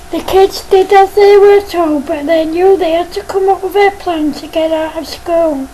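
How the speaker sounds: plain reading voice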